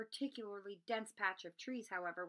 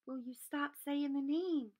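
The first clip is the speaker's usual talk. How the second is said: hissing; 6 semitones higher, 4 dB louder, slower than usual. Acted. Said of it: "Ron hissed".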